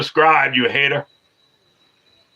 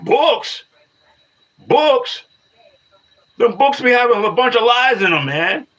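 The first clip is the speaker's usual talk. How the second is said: scoffing disbelief; 11 semitones higher than usual. Real, not acted. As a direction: scoff at the idea, pitch shooting up. Scoffing at a commenter's source.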